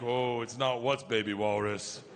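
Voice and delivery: low, slow and deliberate; ominous correction. Retold or imitated. Daughters freeze, then correct dad, ominously. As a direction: correct him slowly, drawing it out.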